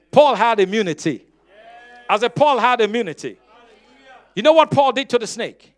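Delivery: loud, driving pulpit delivery; full-voiced sermon proclamation; preaching at full force. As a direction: preach it out to the room. Preacher raising voice to congregation.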